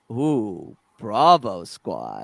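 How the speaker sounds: low, slow, impressed clone voice; drawn-out low "Ooh, Bravo Squad"; impressed Heavy. Retold or imitated. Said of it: "Isn't it Heavy who goes".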